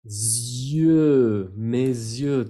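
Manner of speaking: mes yeux with a drawn-out Z before yeux; careful liaison. Shown as a demonstration. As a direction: stretch the link, mez-yeux. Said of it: "Tratan de pronunciarlo así".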